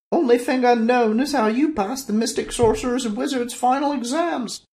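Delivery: very high, loud sneer; snorting woman. Acted. Woman sneering at the sorcerer's exams, very high. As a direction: jab at his exams, high. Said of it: "The woman snorted".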